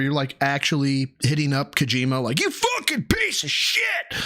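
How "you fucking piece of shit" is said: high, slow strained yell; a furious high-pitched yell, hammed-up; imitated rage scream. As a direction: scream it at the developer in mock fury. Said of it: "That's rage".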